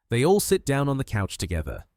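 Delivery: ordinary delivery, nothing marked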